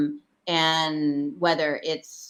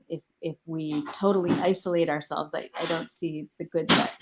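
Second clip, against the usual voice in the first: ordinary delivery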